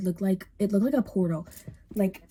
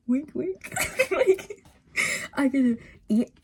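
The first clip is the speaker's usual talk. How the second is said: playful innuendo; 6 semitones higher, slower than usual. Pretend. Joking innuendo about empty house.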